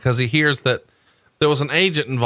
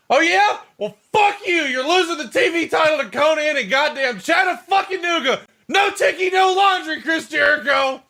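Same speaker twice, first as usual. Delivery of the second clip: much higher, shouted mock rant; screeching parody of a furious boss. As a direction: ham up a furious boss firing off insults. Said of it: "that entire scene is hilarious to me".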